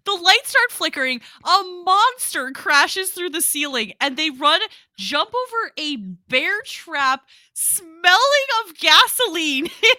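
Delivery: high, loud telling through laughter; cracking up while recounting the chaos; incredulous laughing. Real, not acted. Cracking up while recounting chaotic scene.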